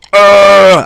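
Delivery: a loud, high cry of pain. Acted. Under the hard slap, she cries out loud and high in pain. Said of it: "I cry out".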